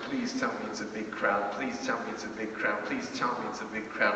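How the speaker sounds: soft, quick anxious murmur to yourself; nervous muttering. Retold or imitated. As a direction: pray under your breath it's a big crowd. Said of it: "I'm really nervous".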